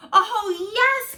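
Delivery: very high, loud delighted yes; excited cry. Acted. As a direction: kids cheering for story hour. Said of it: "cried the kids".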